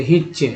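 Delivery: "हिच" ending in a clear "च" sound; TCH as "च". Shown as a demonstration. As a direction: show "हिच" with TCH said as one "ch". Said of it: "टी सी एच को च बोल रहे थे". ("हिच" के अंत में साफ़ "च")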